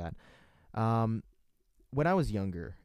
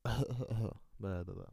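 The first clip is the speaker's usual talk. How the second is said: coughing voice; 3 dB quieter, much slower than usual. Shown as a demonstration. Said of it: "I can do a coughing voice".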